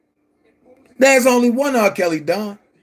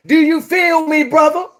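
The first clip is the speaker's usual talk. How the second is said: lightly imitated, slightly higher-pitched catchphrase; a hint of someone else's voice, pitched up; Levi voice; 4 semitones higher than usual. Challenging Don Russell and Chicago in a light Levi impression. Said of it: "In my Levi voice".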